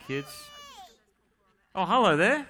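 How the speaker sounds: usual speaking voice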